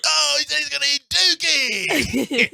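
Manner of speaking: radio host. Retold or imitated. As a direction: announce it to the crowd like a DJ. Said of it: "He's like".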